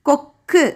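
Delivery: "கொக்கு" in two beats with a held k; doubled consonant demo. Shown as a demonstration. Teacher checking children can hear the sound, split-word demo. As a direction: pause on the க் in "கொக்கு" before releasing கு. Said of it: "சத்தம் கேக்குதா உங்களுக்கு?".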